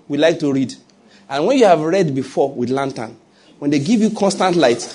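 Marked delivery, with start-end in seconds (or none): none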